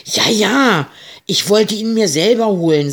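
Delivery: high, loud hurried assurance; quick. A narrator's reading. Captain hastily assures the pirate, high and loud. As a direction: rush it out to convince him. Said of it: "sagte der Kapitän schnell".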